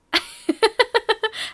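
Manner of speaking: high cackling laugh; loud burst of laughter. Real, not acted. Host cracking up at her own name-change joke, loud laughter. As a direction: break into a big laugh.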